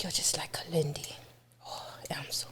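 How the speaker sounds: put-on soft whisper in the old lady's voice; hushed retold whisper of the African godmother; whispered imitation. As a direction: whisper it with awe, like an elder blessing. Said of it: "that's the voice she was using".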